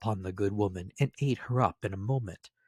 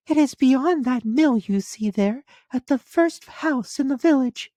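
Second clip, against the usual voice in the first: put-on much higher, louder child voice; little girl voice; 7 dB louder than usual. A narrator's reading. Little girl answering the wolf, high and childlike. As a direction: pipe it up like a small girl.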